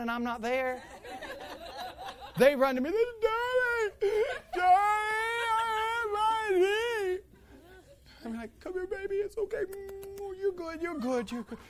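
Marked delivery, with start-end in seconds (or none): 2.9-7.2 put-on very high crying kid voice; mock tearful little-kid squeal; imitated wailing child. Imitating hurt children running to father. 8.6-11.6 high, cooing reassurance; acted high-pitched comforting daddy voice; soothing baby talk. Acting out comforting a hurt child.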